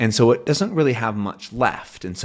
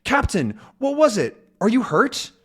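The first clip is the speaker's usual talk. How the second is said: much higher, anxious questions; worried call; 12 semitones higher than usual. Acted. Tintin runs to the fallen captain, anxious and high. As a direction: rush to him, worried. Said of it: "he goes up and".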